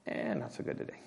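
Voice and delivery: breathy, soft unimpressed verdict; wife's shrug. Retold or imitated. Wife answering her preacher husband, unvoiced shrug. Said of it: "she'll say".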